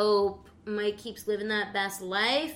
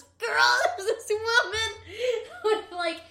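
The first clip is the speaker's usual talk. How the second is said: high, laughing so hard it sounds tearful; helpless real laughter through the words; 12 semitones higher, slower than usual. Real, not acted. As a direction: crack up while trying to describe her. Cracking up describing a contestant.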